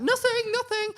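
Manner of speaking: very high squeaky backing off; nervous squeak. Retold or imitated. Teen losing nerve before telling mum, nervous squeak. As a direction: squeak it out and retreat.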